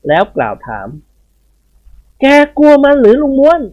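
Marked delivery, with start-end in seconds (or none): 1.6-3.6 higher, louder, slow question; questioning master. Acted. A master asks the old head gardener if he is afraid, higher and louder. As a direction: call the old man out, loud and slow.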